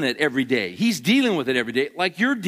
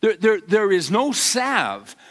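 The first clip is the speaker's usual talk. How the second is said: ordinary talk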